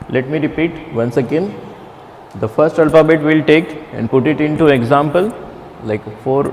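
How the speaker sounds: ordinary talk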